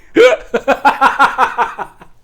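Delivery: big loud cackle, falling away; real loud belly laugh; loud laughing fit. Real, not acted. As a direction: burst out laughing loudly. Still laughing at the movie.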